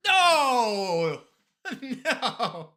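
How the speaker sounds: high, loud cry of despair, then a laugh; anguished no. Real, not acted. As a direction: wail it like the guess just died. Wrong guess in the game.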